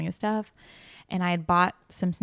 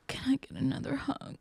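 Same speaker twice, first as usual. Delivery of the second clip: high, soft, whispery imitation; mock tearful plea; 7 semitones higher, 5 dB quieter than usual. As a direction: imitate a meek, weepy request. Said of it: "Cries again".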